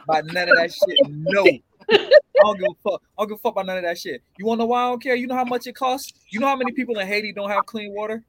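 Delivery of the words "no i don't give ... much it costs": ordinary delivery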